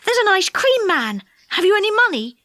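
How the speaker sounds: put-on eager, high boy's voice; bubbling over about the ice cream man; excited Joe. A narrator's reading.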